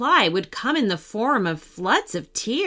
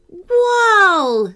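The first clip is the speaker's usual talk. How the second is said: high, loud, long "whoa"; utterly impressed; 9 semitones higher, 6 dB louder than usual. A narrator's reading. Jacob sees the car grow, utterly impressed. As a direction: let out an awestruck whoa. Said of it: "utterly impressed".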